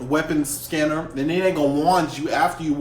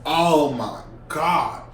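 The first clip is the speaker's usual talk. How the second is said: dismayed; much slower than usual. Retold or imitated. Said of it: "So I'm like".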